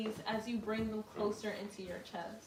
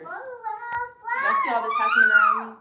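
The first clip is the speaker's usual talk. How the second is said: much higher, loud mock-exasperated shout; 13 semitones higher, 12 dB louder than usual. Real, not acted. Telling viewers about kids' chaos, loud exasperated shout. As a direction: throw it at the viewers over the chaos. Chaos from kids during yoga.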